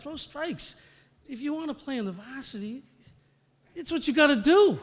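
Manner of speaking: high-pitched mom laying down the law; imitated scolding mother. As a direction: impersonate a mother justifying herself. Mother justifies her yelling, retold.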